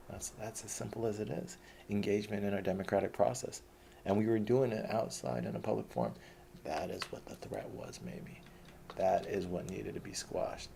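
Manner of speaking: ordinary delivery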